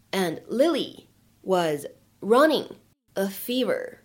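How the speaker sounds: slow dictation with pauses between phrases; measured, piece-by-piece model reading; slow, word by word. Shown as a demonstration. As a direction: read it in small separated chunks for learners. Teacher reads passage word by word.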